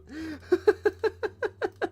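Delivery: high breathy giggle. Real, not acted. Laughing at donation message.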